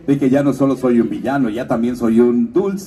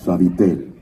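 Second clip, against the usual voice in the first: lower, gentle commercial read; tender ad slogan; 8 semitones lower than usual. Shown as a demonstration. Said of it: "recordarán que decía".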